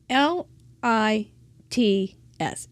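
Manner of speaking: letters spelled slowly one by one; spelling it out. Shown as a demonstration. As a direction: spell out L I T S deliberately. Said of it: "I keep saying later in the show".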